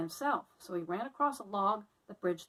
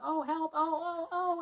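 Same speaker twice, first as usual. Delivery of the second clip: higher and higher frightened oh's; panicked cries; 8 semitones higher, 4 dB louder than usual. Acted. Annie fleeing through the city, panicked squeals. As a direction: squeal in panic while running.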